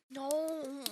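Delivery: ordinary talk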